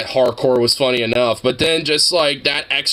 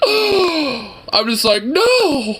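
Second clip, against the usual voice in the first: much higher, slow, stretched gasp and no; mock shocked gasp; 13 semitones higher, much slower than usual. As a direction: play the betrayed friend. Retelling being gaslit in chat.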